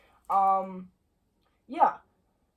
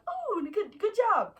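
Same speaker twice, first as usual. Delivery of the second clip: self-praise; 12 semitones higher, much slower than usual. Retold or imitated. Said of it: "I was like".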